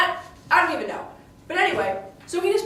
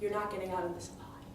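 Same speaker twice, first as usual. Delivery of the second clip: low, soft, menacing promise; much lower and softer vow of revenge; quiet threat; 7 semitones lower, 14 dB quieter, faster than usual. Acted. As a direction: lower the voice and threaten him coldly. Threat to Othello over the body.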